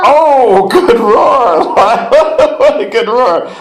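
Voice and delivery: high, loud delighted praise with laughter; excited praise. Real, not acted. Parent praising child's roar.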